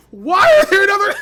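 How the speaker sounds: high, loud laugh breaking through the rant; exasperated laughter. Real, not acted. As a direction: laugh in disbelief at the result.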